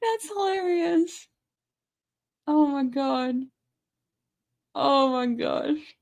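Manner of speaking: high, soft, drawn-out and weepy from laughing; teary with laughter. Real, not acted. Streamer recovering from a laughing fit, teary with laughter. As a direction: squeak it out, spent from laughing.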